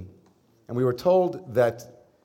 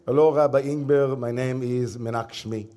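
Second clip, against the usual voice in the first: put-on Israeli accent. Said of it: "I'm someone who's good at accents".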